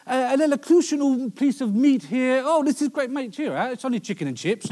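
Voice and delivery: high, loud hammed-up posh praise; mock refined voice gushing over chicken; mock posh guest. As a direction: overdo the elegant dinner guest. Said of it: "it's like".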